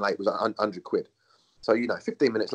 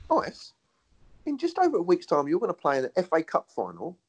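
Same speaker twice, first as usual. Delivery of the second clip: higher, hammed-up coach voice; mock pep talk; 10 semitones higher than usual. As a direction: play the coach winding him up, pitched high. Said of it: "Why does he go".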